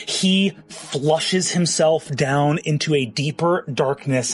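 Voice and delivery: ordinary talk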